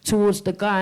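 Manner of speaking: usual speaking voice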